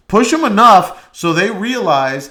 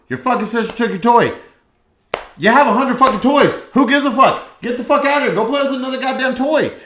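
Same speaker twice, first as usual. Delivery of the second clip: fast, higher, louder exasperated dad; hammed-up irritated father yelling; mock parent rant; 8 semitones higher, 4 dB louder, faster than usual. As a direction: snap at the kid like a fed-up parent. Parent ranting at kid.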